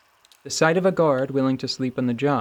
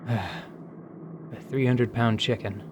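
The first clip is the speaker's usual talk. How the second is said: reluctant sigh; 2 semitones lower, 5 dB quieter, much slower than usual. Acted. Reluctant answer after being pressed.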